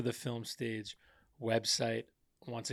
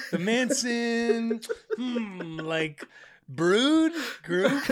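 slow, high, searching mock voice; drawn-out fumbling for the word; imitating groping for a word; 9 semitones higher, much slower than usual. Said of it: "You're like".

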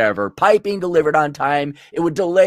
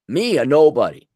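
usual speaking voice

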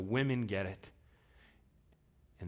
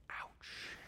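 whispered ouch; 13 dB quieter than usual. Real, not acted. Reacting to the price.

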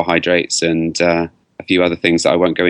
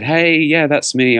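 higher-pitched mock-eager voice; eager recognition; 9 semitones higher, slower than usual. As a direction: imitate someone keenly volunteering themselves. Voicing eager people who came forward.

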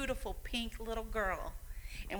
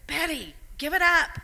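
mock gruff husband, pitched high and loud; playfully voicing her husband telling her to stop; husband's voice; 8 semitones higher, 8 dB louder than usual. Husband telling talkative Betty to quit, mock voice. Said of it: "My husband says".

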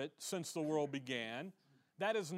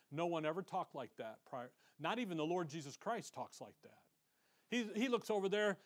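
ordinary talk

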